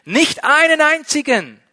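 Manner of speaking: high, loud call back. Acted. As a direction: shout the answer across the distance. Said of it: "riefen sie zurück".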